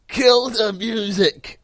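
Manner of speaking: high, loud, slow shouted command; wrestler yell. Retold or imitated. Wrestler grabbing the mic to stop his music, shouting. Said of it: "he just goes".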